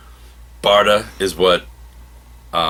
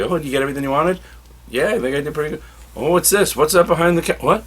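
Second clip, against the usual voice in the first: higher-pitched playful present-giving chatter; gift-giving scene; 7 semitones higher, faster than usual. Acted. Parent brings out a last present, playful high voice. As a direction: act out a teasing surprise reveal.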